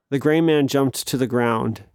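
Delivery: usual speaking voice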